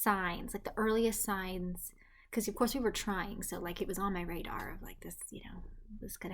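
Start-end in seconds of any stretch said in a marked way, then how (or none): 3.4-6.3 hushed whisper. Real, not acted. Secretly trying for a baby.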